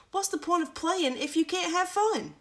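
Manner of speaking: put-on high, bright boyish voice; high child. Carl answers Clara lying in the grass, high. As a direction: lift it high like a cheeky boy.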